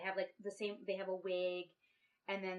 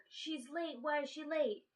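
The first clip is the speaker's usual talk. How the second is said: higher-pitched mock worry; retold alarm; 6 semitones higher than usual. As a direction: voice their fretting in a raised pitch. Retold housemates alarmed at her absence.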